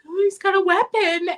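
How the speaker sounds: put-on high, loud, alarmed kid reaction; mock panic. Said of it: "like, panicking".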